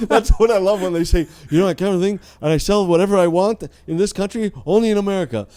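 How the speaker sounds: high, loud mock enthusiasm; boastful imitation. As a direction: crow it like a proud patriot. Mimics documentary's boastful Americans.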